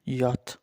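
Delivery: यॉथ said as German J; teacher demonstrating यॉथ for the German J; German letter name yoth. Shown as a demonstration. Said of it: "German में इसको यॉथ बोलते हैं".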